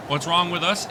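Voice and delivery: usual speaking voice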